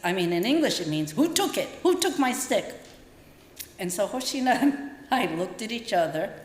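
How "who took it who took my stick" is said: put-on high, upset old man asking for his stick; old man's demand. Old Mister Onihashi fretting over his missing stick.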